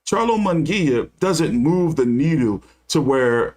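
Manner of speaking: ordinary talk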